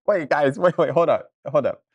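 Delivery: loud, urgent wait; excited interruption. Real, not acted. Streamer stopping chat in excitement, loud. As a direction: stop everyone, excited.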